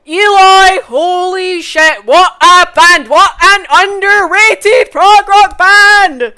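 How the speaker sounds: loud, high, drawn-out raving shout; excited shouting. Real, not acted. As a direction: yell your amazement at full volume. Raving about a favourite band.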